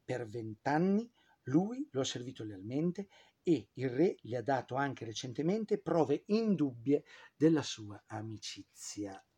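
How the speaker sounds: usual speaking voice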